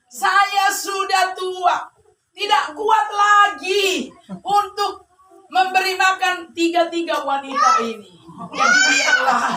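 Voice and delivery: slow, high mock lament; whining plea. Old man pleading too weak for three wives, mock tearful. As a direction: drag out the words in comic self-pity.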